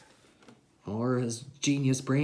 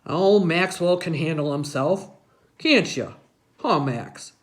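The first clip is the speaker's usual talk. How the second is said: a hint of a hearty old man, louder; lightly voiced grandpa; 2 semitones higher, 5 dB louder than usual. A narrator's reading. Grandpa backing up the boy, a hint of hearty. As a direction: nudge him like a jovial grandpa. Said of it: "he goes".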